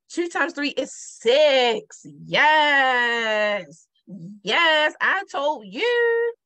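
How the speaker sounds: slow, loud proud reveal of the answer; triumphant answer. Acted. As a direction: announce the answer like a victory.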